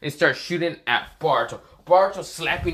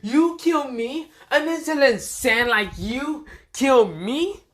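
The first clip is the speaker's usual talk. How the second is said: Frio's mocking villain; 11 semitones higher, 4 dB louder than usual. Frio scoffing at Boruto's threat, high mocking villain voice. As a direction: laugh loudly, then question in a high scornful voice. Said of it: "this is what Frio says".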